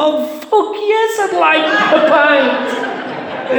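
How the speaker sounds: very high, drawn-out cry; acted squeal of craving, stretched right out; desperate relief. Shaky drinker answering the barmaid's offer, acted desperate eagerness.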